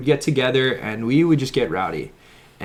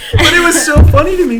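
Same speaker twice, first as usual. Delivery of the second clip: fast, high, laughing through it; 12 semitones higher, 7 dB louder, faster than usual. Real, not acted. Telling friends about the call, laughing. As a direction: giggle through the line.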